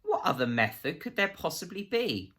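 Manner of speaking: blustering dismissal of the suggestion; barking laugh. A narrator's reading. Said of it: "barked with laughter".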